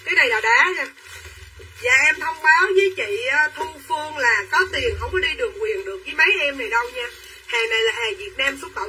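ordinary talk

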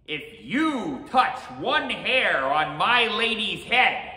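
high, slow heroic shout; great-voiced hero. A narrator's reading. Tiny samurai defying the Oni to guard his lady, booming defiance. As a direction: declaim the threat at full volume. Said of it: "great of heart and still greater of voice".

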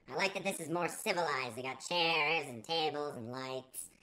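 usual speaking voice

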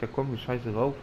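ordinary talk, nothing marked

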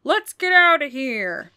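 a hammed-up father's shout. A narrator's reading. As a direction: yell it to get everyone out. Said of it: "shouted at the top of his lungs".

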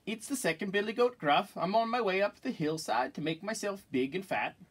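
middle-sized goat, high but no squeak; not so small voice. Acted. Second goat answering the troll, moderately high voice. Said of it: "his voice was not so small".

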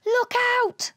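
high, urgent warning cry; alarmed, high-pitched shout; cried warning. A narrator's reading. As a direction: call out sudden danger. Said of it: "cried Ugg".

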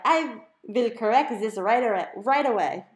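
high, loud, hammed-up German accent; an overeager German butler voice; German computer squeal. A narrator's reading. Home computer answering Mom, squealing in a German accent. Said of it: "high-pitched German accent".

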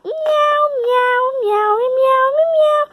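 high, repeated kitty meows; cat meowing. Acted. Playing with the toy kitty cat, meowing. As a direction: mew like a kitten.

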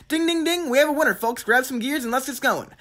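high-pitched winner announcement; mock game-show host. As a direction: play the host declaring a winner.

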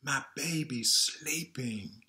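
sing-song read-aloud lilt; reading to children. A narrator's reading. Reading a lullaby book to kids, sing-song. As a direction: read it gently to a little one.

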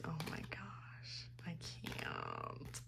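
overwhelmed whisper; whispering, lost for words; breathless whisper. Real, not acted. Fangirling over the group.

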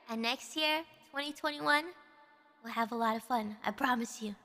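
soft heartfelt message; sincere. Real, not acted. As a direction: speak softly from the heart.

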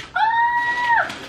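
very high delighted shriek; excited scream. Real, not acted. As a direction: let out a joyful squeal. Seeing the package contents.

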